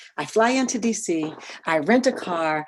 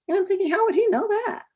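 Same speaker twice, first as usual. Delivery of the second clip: much higher, incredulous reaction; surprised by what the man knows; 13 semitones higher, faster than usual. Retold or imitated. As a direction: sound taken aback and doubting.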